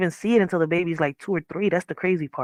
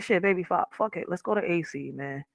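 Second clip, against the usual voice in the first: quiet plea to partner; 10 dB quieter than usual. Retold or imitated. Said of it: "I'm like".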